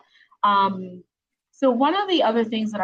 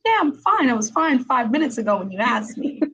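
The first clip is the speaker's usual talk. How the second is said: higher-pitched mock teenage exasperation; exasperated son; 4 semitones higher than usual. Mom imitating her fourteen-year-old after checking on him, exasperated. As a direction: imitate a pestered kid brushing off a parent.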